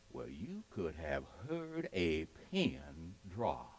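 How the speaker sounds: very soft, low, suspenseful hush; hushed storytelling. Acted. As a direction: drop right down as if the room fell silent. Storyteller evokes the stunned silence.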